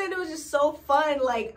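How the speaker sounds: normal talking voice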